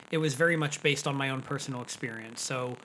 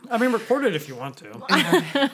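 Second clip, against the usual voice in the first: laughing with food in mouth; mouth full; 9 semitones higher, 9 dB louder than usual. Real, not acted. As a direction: talk through a bite, giggling. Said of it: "while I've got something in my mouth".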